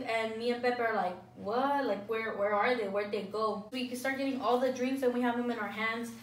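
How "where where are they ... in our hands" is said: ordinary talk, nothing marked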